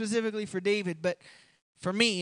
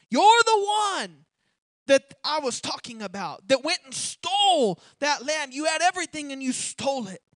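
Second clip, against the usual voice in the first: the prophet nails the guilty king, hammering the words home; accusing shout; 6 dB louder than usual. Acted. Nathan confronting King David with his sin, loud and accusing.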